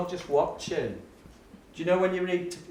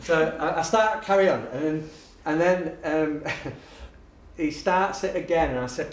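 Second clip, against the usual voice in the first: ordinary talk, nothing marked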